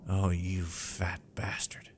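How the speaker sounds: hushed muttered aside; whispered curse. Acted. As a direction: breathe it under your breath. Muttered aside after the shout.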